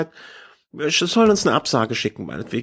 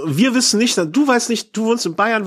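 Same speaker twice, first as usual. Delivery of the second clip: mock indignant; 9 semitones higher, 4 dB louder than usual. Real, not acted. Mock outrage at colleague.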